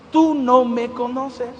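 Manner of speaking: slow, low voice from beyond; voice of God. Retold or imitated. The voice answers the sick man, slow and low. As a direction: say it slowly and deep, with weight. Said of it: "volvió la voz y me dijo".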